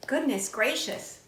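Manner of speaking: ordinary delivery, nothing marked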